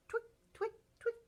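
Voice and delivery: high squeaky twick sound; toenail twick. Shown as a demonstration. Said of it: "They go twick".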